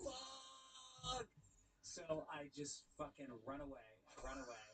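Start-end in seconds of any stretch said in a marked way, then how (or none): 0.0-1.3 shocked. Retold or imitated. Retold exclamation.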